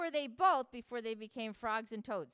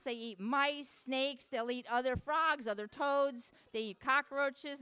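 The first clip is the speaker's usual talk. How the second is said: ordinary delivery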